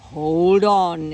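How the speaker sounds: loud lion roar of a command. Acted. Aslan roars to the girls, loud and commanding. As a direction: boom it out like a lion. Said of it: "he roared".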